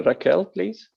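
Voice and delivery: usual speaking voice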